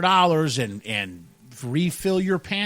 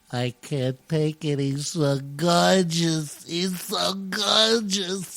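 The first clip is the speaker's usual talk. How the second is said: slow, swooning mock fan voice, drawn out; swooning women; much slower than usual. Women ogling the host, slow dreamy swoon. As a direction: gush like a smitten fan. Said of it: "where the women are like".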